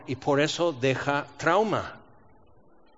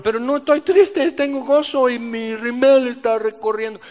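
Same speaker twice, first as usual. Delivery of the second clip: high, louder protest through tears; protesting crier; 6 semitones higher, 4 dB louder than usual. Pretend. Woman crying with joy explains herself, high protest. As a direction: insist you're happy while mascara runs.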